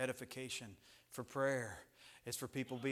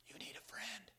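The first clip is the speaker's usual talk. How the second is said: soft pulpit whisper; whispered counsel; 8 semitones higher, 11 dB quieter than usual. Real, not acted. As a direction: drop to a whisper for the point. Preacher drops to a whisper.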